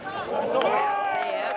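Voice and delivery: high, loud shot call; excited call. Real, not acted. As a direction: urge the ball on as it flies. Calling the ball's flight.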